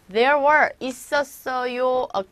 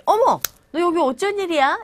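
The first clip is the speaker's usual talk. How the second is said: surprised; 7 semitones higher, 4 dB louder than usual. Pretend. Surprised meeting acquaintance.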